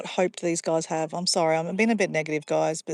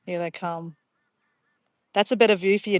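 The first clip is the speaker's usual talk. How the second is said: normal talking voice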